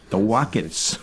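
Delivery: amused mock German Wockets; laughing Wockets. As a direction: repeat The Wockets, chuckling at the accent. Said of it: "Werner von Braun talking about the Wockets".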